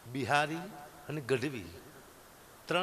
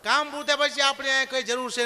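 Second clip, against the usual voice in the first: raised high and loud, driving the point home; loud emphatic; 9 semitones higher, 9 dB louder than usual. Real, not acted. To the audience about finishing work, a loud emphatic punch. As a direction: hammer the punchline at the crowd.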